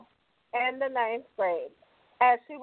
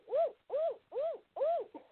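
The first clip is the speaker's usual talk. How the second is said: high insistent grandma; 13 semitones higher, 7 dB quieter, slower than usual. Retold or imitated. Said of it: "she goes".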